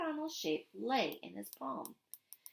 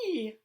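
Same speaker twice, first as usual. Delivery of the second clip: short squeal; 6 dB louder than usual. Acted. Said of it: "Meg squealed".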